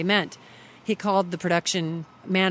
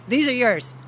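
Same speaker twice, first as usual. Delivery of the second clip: louder, higher imitated order; brusque retold command, raised voice; curt handover; 6 semitones higher, 6 dB louder than usual. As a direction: imitate a boss dumping a chore on a newcomer. Imitating DP handing over his things.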